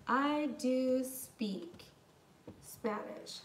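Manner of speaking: "do" leaned on in a slow, spaced-out phrase; stress on DO. Shown as a demonstration. Teacher shows students an emphatic statement, stress on "do". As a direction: say "I do speak" slowly, weight on "do". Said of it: "It is adding emphasis".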